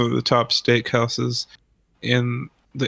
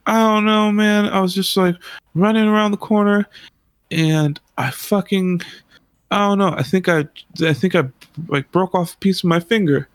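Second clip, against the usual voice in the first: panicked; 6 semitones higher than usual. Retold or imitated. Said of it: "He's like".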